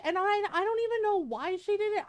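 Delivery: high, fast, worked-up disbelief; exasperated. Real, not acted. Exasperated rant about neighbor.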